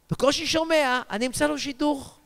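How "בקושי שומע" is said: normal talking voice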